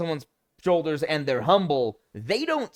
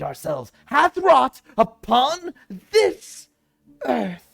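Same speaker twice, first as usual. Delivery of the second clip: hammed-up grand speech, much higher, louder and slow; pretentious savior; 9 semitones higher, 7 dB louder, much slower than usual. Mocking a pretentious game villain's speech, high and slow. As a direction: declaim it like a pompous hero saving mankind. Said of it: "someone going out with the pretentious attitude".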